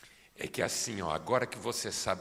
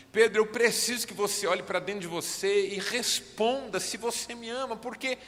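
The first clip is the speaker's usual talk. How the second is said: far higher and louder, sustained shouting; shouted preaching; 24 dB louder than usual. Acted. Preacher urging the church to love the Lord, in full sustained shouting. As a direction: preach at the top of your voice, pleading.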